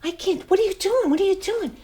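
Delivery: high, soft, mousy self-doubt; a hint of a frightened little mouse; timid little mouse voice. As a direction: squeak it like a scared house mouse. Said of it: "referring to myself as a little house mouse".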